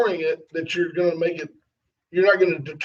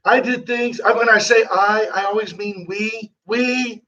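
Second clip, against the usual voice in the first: higher-pitched boastful Trump voice; raised-pitch Trump impression, pausing between boasts; Trump impersonation; 4 semitones higher than usual. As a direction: bring it back round like Trump, self-congratulating. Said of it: "You gotta bring it back to him".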